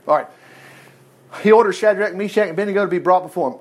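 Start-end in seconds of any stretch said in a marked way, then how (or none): none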